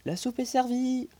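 put-on high, bright call of an old servant; housekeeper announcing. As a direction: announce dinner from the doorway, pitched high.

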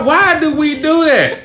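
high, drawn-out baffled question; laughing exasperation. Retold or imitated. Storyteller baffled by brother's behaviour, high exasperated wail. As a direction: plead it high and slow, half laughing.

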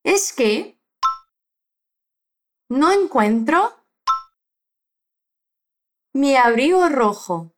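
"Es que no encuentro mi abrigo rojo" said slowly in chunks with pauses; slow broken model. Shown as a demonstration. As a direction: break the sentence into chunks for repeating. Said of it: "depois de mim". (frase dita devagar em partes)

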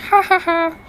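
high, sung-out ha ha ha; laughing at herself. Real, not acted. Laughing at her own failed singing, high. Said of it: "my failed attempt at singing".

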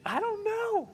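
very high, squeaky I don't know; helpless husband. Retold or imitated. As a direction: shrug it off helplessly. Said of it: "husband answering".

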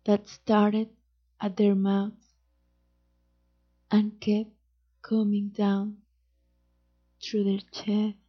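plain reading voice

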